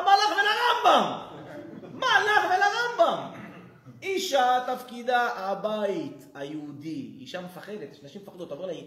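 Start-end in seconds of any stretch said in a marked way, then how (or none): 0.0-3.4 much higher, slow, loud mocking retort; mock rabbi retort. Rabbi scoffs back at the woman, slow loud mockery. 4.0-7.2 slow, loud, weighty pronouncement; slow rabbi verdict. Retold or imitated. Rabbi lays down the rule, slow and loud.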